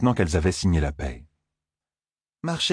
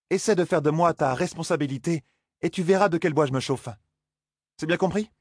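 higher, sharp challenge; Zarine, defiant young woman; 10 semitones higher than usual. Acted. Zarine warning Perrine off, higher and defiant. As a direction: dare him to try it.

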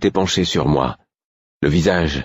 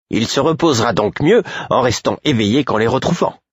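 unyielding retort; 7 semitones higher than usual. Acted. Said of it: "rétorqua Caudron, inflexible".

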